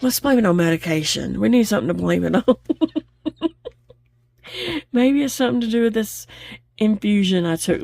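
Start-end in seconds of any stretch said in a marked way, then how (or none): 2.5-3.0 quick high giggle; amused laugh. Real, not acted. Giggling to viewers about blaming the medication. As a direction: giggle at her own excuse.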